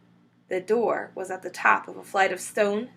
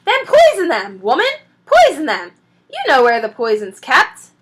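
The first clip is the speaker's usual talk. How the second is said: loud, higher, exasperated order; angry shout; 5 semitones higher, 10 dB louder than usual. A narrator's reading. Mrs Bean snaps at Mabel from upstairs, loud and exasperated. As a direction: bark the order down at her.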